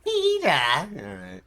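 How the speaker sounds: high, loud call of a name; Cleveland impression. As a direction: call out in a squeaky cartoon voice. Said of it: "Cleveland from Family Guy".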